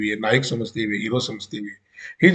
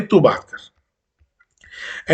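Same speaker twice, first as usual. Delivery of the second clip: pointless challenge; much slower than usual. Retold or imitated. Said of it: "in a tone of pointless challenge".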